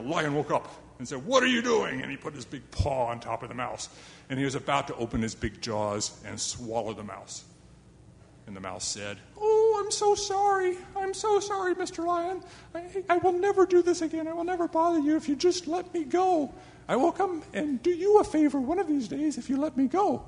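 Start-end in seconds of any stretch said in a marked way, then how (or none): 1.2-2.0 loud, high startled lion demand; lion roar question. Acted. Woken lion challenges the mouse, loud and high. 9.4-20.2 very high, pleading little mouse; squeaky mouse voice. Acted. Mouse begging the lion for mercy, high squeaky voice. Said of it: "That's the closest I can come to a mouse voice".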